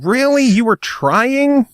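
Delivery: high, slow disbelief; incredulous. Real, not acted. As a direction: tease him in disbelief. Incredulous teasing of the accent.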